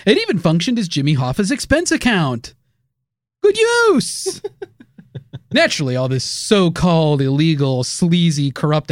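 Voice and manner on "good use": high sarcastic echo. Pretend. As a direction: say it dripping with irony.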